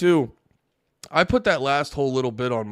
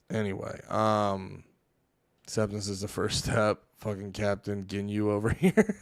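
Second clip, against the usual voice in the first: ordinary talk, nothing marked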